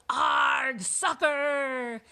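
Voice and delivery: loud, high pirate war cry; pirate yell. A narrator's reading. Captain kicks the ball, yelling. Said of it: "kicked the ball and yelled".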